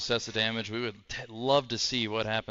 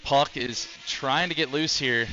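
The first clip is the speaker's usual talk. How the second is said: live fight commentary, raised and eager; excited play-by-play; 4 semitones higher, 3 dB louder than usual. Acted. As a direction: call the action ringside.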